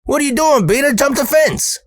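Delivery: high-pitched mocking boss voice; imitated boss calling out orders; Earl heckling. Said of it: "And he says".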